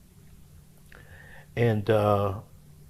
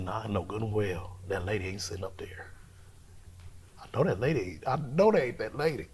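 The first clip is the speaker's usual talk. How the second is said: hushed disbelieving whisper; 7 dB quieter than usual. Retold or imitated. Spotting the mother in the dark, whispering in disbelief. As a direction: whisper it, not believing your eyes. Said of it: "I said".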